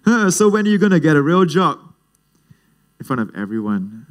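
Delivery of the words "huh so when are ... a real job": high, teasing uncle in a stupor; joking uncle. Retold or imitated. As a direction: needle your nephew in a raised, jokey voice. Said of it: "turns to me in this joking stupor".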